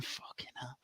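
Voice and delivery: breathy under-the-breath swear, voiceless; whispered curse. Real, not acted. Podcaster cursing under his breath at Sorensen's name, whispered. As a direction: mutter it to yourself in disgust.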